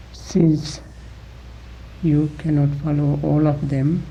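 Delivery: normal talking voice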